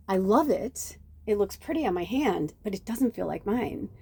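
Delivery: usual speaking voice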